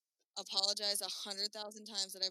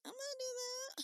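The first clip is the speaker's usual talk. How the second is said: mock eager squeal. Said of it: "and I was like".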